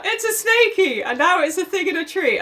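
high-pitched imitation of a small child; high, silly retelling voice; mock little kid. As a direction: mimic a child excitedly changing the story. Mimics the small boy's shifting tale.

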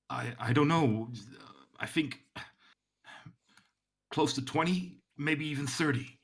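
hesitant estimate. Acted. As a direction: grope for the answer, with long pauses. Guard hesitantly estimates enemy numbers to superior.

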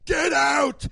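high, loud outburst; hammed-up British chef scream; Gordon screaming. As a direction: cut them off with a furious shout. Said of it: "He screams".

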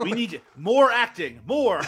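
egging on a hammy actor, laughing; playful shouted plea. Pretend. Mock demand for more acting.

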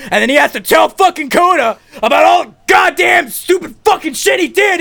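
high, loud comic rant; worked-up shouted storytelling; exasperated shouting. Acted. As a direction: yell it in disbelief at the plot. Exasperated rant about the plot.

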